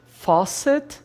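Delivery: Faucet lifted high, rising tune; modelling the word. Shown as a demonstration. Teacher modelling Faucet for students, high with a rising tune. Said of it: "faucet e tap".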